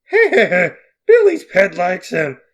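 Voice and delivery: loud, slow cartoon uncle with a laugh; laugh, then draw it out loudly in character; goofy laughing uncle. Uncle Brewston laughs at the pet.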